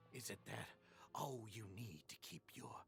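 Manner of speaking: hushed and mocking; sneering low murmur. Taunting his rival about the prisoners in a low sneer.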